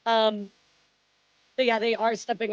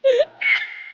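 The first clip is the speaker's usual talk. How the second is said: high squealing laugh; 13 semitones higher, 5 dB louder than usual. Real, not acted. Laughs after choking.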